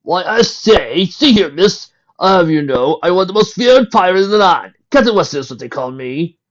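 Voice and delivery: loud, high, pompous pirate captain; extra stuffy voice. A narrator's reading. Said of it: "he replied in his extra stuffy voice".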